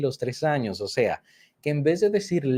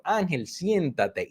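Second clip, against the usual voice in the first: put-on calling a child's name slowly to sit down; a slow, drawn-out order to sit; slow instruction; 4 semitones higher, much slower than usual. Teacher slowly tells the boy to sit down. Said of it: "le decían".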